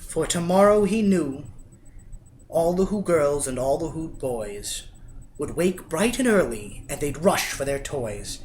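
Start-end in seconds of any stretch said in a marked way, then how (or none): none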